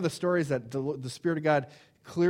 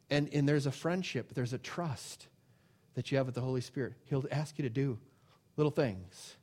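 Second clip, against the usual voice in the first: ordinary talk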